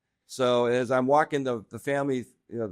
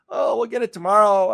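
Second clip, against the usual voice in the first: mocking lazy worker; 8 semitones higher, 6 dB louder than usual. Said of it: "a lot of people don't work that same level".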